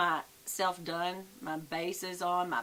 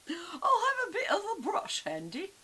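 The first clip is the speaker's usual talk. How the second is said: much higher, louder whiny sing-song repeat; 12 semitones higher, 3 dB louder than usual. Pretend. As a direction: whine it like a spoiled child. Repeats line then whines it.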